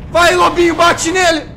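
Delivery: frantic high shouting; being chased. Real, not acted. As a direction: scream in panic as the wolf falls. Wolf fighting, chased, screaming.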